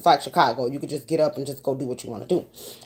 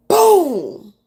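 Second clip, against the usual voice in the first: loud, high mock bang sound; imitated boom; 10 semitones higher, 8 dB louder than usual. Retelling the night the shots hit, loud boom. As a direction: burst out the boom like a gunshot.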